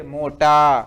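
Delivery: मोटा with the टा held long and loud; long, loud "aa" closing मोटा; stressed final आ. Shown as a demonstration. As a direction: punch out and stretch the last syllable of मोटा. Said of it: "आ का बोध हो रहा है इसमें".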